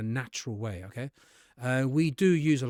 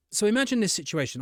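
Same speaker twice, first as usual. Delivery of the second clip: usual speaking voice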